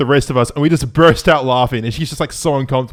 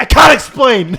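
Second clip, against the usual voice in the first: higher, louder panicked excuse; frantic excuse; 10 semitones higher, 6 dB louder, slower than usual. Retold or imitated. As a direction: blurt it out as you run. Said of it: "He's like".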